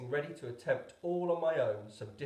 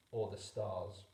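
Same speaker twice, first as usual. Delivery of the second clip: ordinary delivery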